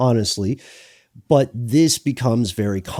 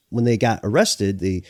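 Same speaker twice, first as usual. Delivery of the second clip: normal talking voice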